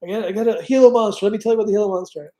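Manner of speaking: high, loud, rushing show-and-tell; excited. Retold or imitated. Telling Freddie about the Gila Monster shirt, giddy and fast. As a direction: gush like an overeager kid. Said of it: "and I'm like".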